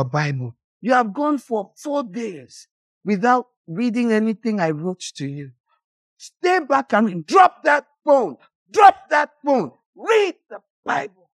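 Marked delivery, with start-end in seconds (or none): 6.2-11.3 commanding shout. Acted. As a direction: bark the order down. Voicing stern command.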